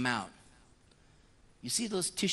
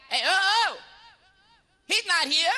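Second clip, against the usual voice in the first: much higher, louder "hey, oh, oh"; mock surprised retort; 7 dB louder than usual. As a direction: snap back with exaggerated surprise. Priest retorting in confession.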